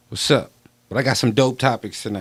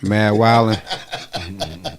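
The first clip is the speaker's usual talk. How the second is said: laughing; much slower than usual. Real, not acted. Repeating friend's accent, laughing.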